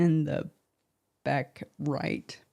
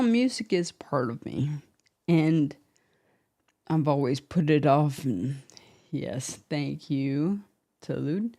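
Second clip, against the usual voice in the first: normal talking voice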